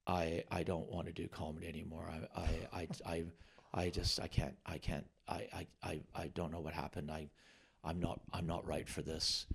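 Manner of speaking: quiet, broken, faltering; retold tearful call, softly stammering. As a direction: call the agent close to tears. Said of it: "and I was in tears".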